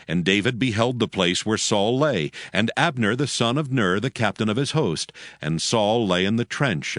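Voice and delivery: ordinary talk, nothing marked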